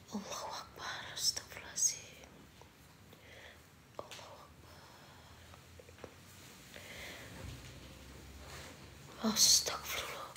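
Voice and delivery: hushed, breath-only invocations; whispered prayer. Real, not acted. Ghost hunter praying after calling out, whispered.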